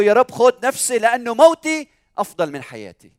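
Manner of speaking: high, loud, tearful-sounding lament acted out; mock weeping plea. Preacher voicing Jonah begging God for death, mock tearful wail. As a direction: plead to God like a grieving prophet, hammed-up. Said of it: "حزن وصار يبكي وقال له".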